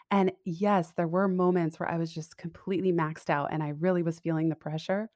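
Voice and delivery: ordinary talk, nothing marked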